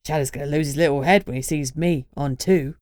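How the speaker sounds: cheeky young Cockney lad, lightly voiced; a grinning Cockney introduction; Cockney accent. Reggie's Cockney voice.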